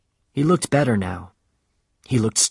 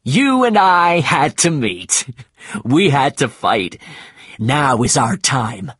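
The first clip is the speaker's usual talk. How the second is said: shouted happily; 3 semitones higher, 4 dB louder, slower than usual. Acted. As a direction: hail the whale like an old rival. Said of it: "He shouted happily at the whale".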